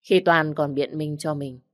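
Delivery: usual speaking voice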